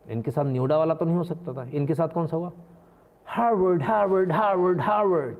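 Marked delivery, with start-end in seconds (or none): 3.3-5.4 mock tout call. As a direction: call Harvard like a bus conductor. Mock calling like bus tout.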